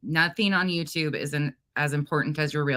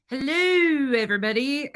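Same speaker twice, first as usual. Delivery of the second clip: bright, much higher and louder sung-out greeting; excited hello; 7 semitones higher, 4 dB louder than usual. Real, not acted. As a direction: call out hello to the whole chat. Greeting live stream viewers.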